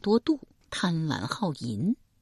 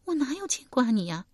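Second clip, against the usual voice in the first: put-on higher, shy young woman's denial; bashful denial; 8 semitones higher, faster than usual. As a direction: deny it, embarrassed. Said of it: "满脸红晕".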